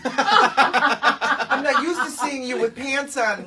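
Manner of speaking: real laughing holler; laughing tease. Real, not acted. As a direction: tease him while cracking up. Mimicking, laughing teasing.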